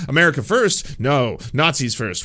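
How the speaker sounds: mocking retort. Mocking the pundits, loud scoffing retort. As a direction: scoff and correct them loudly.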